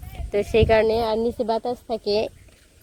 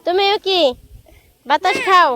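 cooing pet talk; 9 semitones higher than usual. Real, not acted. Talking sweetly to pet Bulu. (পোষা প্রাণীর সাথে উঁচু গলায় আদুরে কথা)

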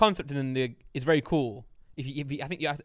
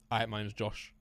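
Josh impression; 4 semitones lower, 7 dB quieter than usual. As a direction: lower and softer, at normal pace. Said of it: "so do a Josh impression".

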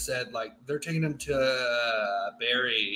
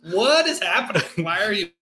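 baffled Fremen; 5 semitones higher, 5 dB louder than usual. Retold or imitated. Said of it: "He's like".

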